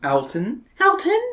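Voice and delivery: second call jumps very high; girl voice calling. Acted. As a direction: call his name in a girlish voice.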